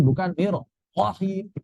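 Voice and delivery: normal talking voice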